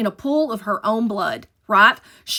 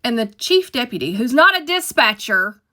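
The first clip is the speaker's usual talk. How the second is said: ordinary talk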